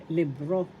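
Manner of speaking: libro rising onto the last syllable; stress on RO. Shown as a demonstration. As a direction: lean on the end of libro: lib-RO. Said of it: "The accent is in the second syllable".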